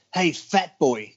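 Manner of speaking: slow, drawn-out gruff call; lightly voiced old gym boss calling; [name] calling out. [name] calling him over.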